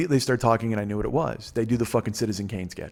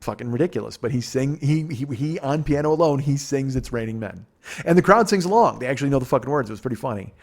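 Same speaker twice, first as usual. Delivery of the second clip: ordinary delivery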